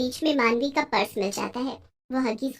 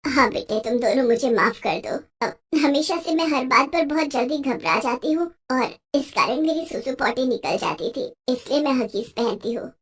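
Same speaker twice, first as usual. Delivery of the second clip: put-on lower, sheepish older woman confessing; ashamed mother-in-law; 3 semitones lower than usual. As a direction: admit the secret with head bowed. (शर्मिंदा सास की धीमी, नीची आवाज़ में कबूलनामा)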